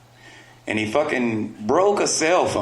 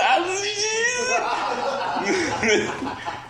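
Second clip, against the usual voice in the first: a shrieking, high laugh at his own joke; high cackling laugh. Real, not acted. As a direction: crack up high and hard.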